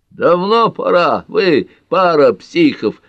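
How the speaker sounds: high, loud, slow exclamation; outspoken exclamation. A narrator's reading. Carter to the newly engaged couple, exclaiming loudly. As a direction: speak out emphatically, drawing the words out. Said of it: "высказался Картер".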